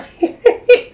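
loud high jackal cackle; high sly cackle. Acted. Jackal gloating over his trick, high cackle. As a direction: cackle at the clever plan.

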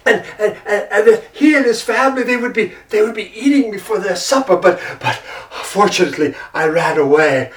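stammering, breathless tiger; flustered tiger. Acted. Tiger telling the monkey his escape, stammering and shaken. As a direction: blurt it out still shaken.

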